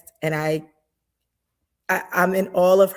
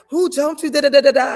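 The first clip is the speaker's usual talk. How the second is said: retold heated demand, higher and louder; 9 semitones higher, 6 dB louder, faster than usual. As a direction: fire it off like you're ready to fight.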